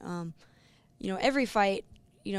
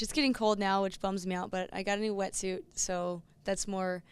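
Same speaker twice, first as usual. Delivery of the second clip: ordinary talk, nothing marked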